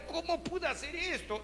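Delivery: high, soft, broken lament; anguished self-reproach. Acted. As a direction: ask it like the weight just hit him. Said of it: "sintió tanto dolor por toda la maldad".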